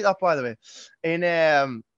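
usual speaking voice

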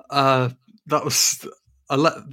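ordinary talk, nothing marked

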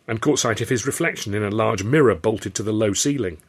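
usual speaking voice

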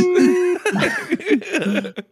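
shrieking burst of laughter; high laugh. Real, not acted. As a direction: lose it laughing. Laughing hard at own joke.

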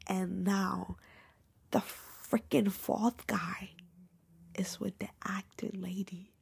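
slow, breathless secret whisper; scandalised whisper; hushed gossip whisper. Real, not acted. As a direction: lean in and whisper the twist slowly.

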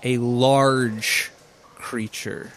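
slow, suspenseful reveal with long pauses; game master's scene setting. Acted. Game master unveils a creature to players, slow narration. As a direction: let each piece of the monster land separately.

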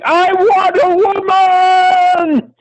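very high, drawn-out wail; hammed-up lonely wail; anguished cry. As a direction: howl it slowly, way up high. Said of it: "a cry is heard".

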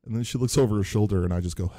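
plain reading voice

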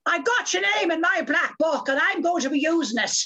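high, emphatic Irish brogue; declaimed, high-pitched Irish accent; Victor McLaglen. Retold or imitated. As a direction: imitate a blustering big man's threat in an Irish accent. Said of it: "and Victor McLaughlin, the big man".

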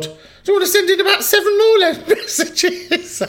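high, laughing through the words. Real, not acted. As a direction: giggle while asking. Laughing at long messages.

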